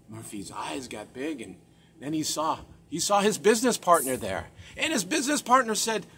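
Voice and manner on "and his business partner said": usual speaking voice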